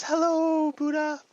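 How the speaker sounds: very high, squeaky little spider voice. Acted. Little spider greeting Buddha, very high falsetto creature voice. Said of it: "a little spider comes along and says".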